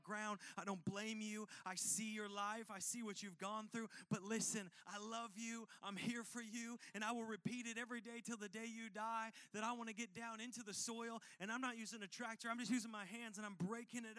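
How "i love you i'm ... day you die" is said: ordinary talk, nothing marked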